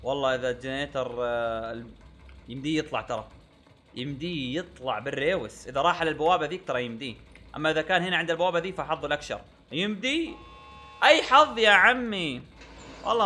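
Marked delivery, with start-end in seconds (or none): none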